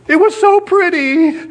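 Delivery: put-on very high, sweet child voice; a small girl explaining innocently; hammed-up high little girl voice. Little girl's answer in retold story.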